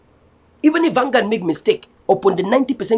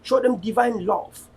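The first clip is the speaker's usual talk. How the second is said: softer, earnest closing prayer; 4 semitones lower, 4 dB quieter than usual. Real, not acted. Leader praying for fellow Ambazonians, softer and earnest. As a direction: pray over your people, hushed and sincere.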